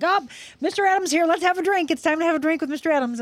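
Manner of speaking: high-pitched, festive call to drink; rally everyone for a toast, high and eager; excited group cheer. Retold or imitated. Friends toasting her dad's arrival, excited.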